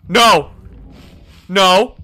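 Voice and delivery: loud desperate shout of no; terrified no. Real, not acted. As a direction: scream it as it closes in. Monster corners the player.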